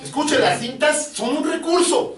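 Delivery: high, slowed, ringing pulpit delivery; emphatic preaching. Real, not acted. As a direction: drive each point home to the congregation.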